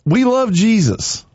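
usual speaking voice

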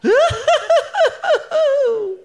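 high shrieking laugh; a loud, high cackle of laughter; old woman shrieks with laughter, high; scream of laughter. Acted. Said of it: "gave out a scream of laughter".

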